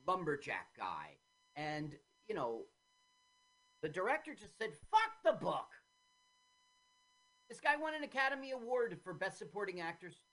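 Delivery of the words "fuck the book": mock blunt dismissal. Quoting the director's dismissal.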